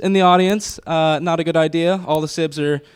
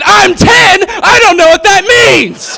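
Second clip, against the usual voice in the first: put-on much higher, loud kid yell; a hammed-up yelling little sister; shouting kid; 14 semitones higher, 7 dB louder, slower than usual. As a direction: shriek it like a bratty ten-year-old.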